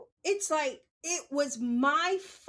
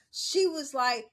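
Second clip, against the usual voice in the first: normal talking voice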